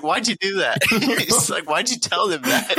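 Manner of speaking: laughing so hard the question squeaks up high; giggling mock scolding, high; laughing while quoting.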